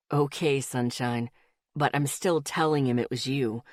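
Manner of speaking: easygoing guy, voiced a bit low; low male voice. A narrator's reading.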